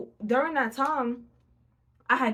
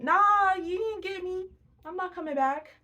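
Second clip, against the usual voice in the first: much higher, playful brush-off; gloating refusal; 9 semitones higher than usual. Retold or imitated. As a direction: taunt the boss who keeps calling. Mocking ex-employer who keeps calling.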